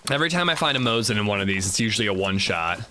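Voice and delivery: normal talking voice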